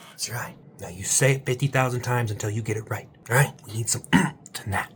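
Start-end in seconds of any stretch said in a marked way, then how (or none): none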